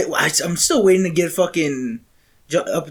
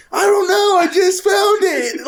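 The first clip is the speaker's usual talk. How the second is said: retold shout, slowed on "found it"; mock shout; 9 semitones higher than usual. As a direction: shout it, goofy and proud. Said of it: "I was like".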